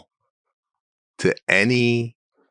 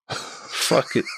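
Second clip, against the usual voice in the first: muttered under breath; 3 semitones higher, 5 dB quieter than usual. Retold or imitated. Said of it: "I'm looking at her going".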